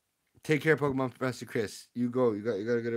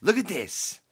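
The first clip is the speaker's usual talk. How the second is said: excited; 7 semitones higher, 6 dB louder, slower than usual. Real, not acted. Showing off a new card.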